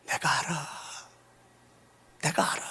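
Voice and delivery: soft whisper of comfort. Retold or imitated. Bereaved mother whispering comfort to grieving friend. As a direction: breathe it close into her ear. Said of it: "이렇게 말하더라고요".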